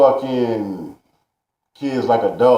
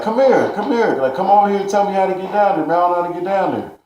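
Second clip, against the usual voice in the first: higher, fast coaxing stranger voice; hurried mock stranger pleading; pushy stranger; 8 semitones higher, faster than usual. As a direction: imitate the man urging him closer, quickly. Stranger insisting, come here.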